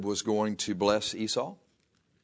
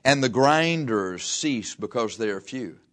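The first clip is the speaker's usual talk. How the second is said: slow, weighty verse reading; measured pulpit reading; solemn scripture reading; 4 dB louder, slower than usual. Acted. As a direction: draw the verse out slowly.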